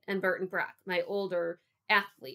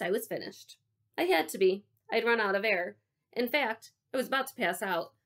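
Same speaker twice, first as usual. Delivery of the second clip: usual speaking voice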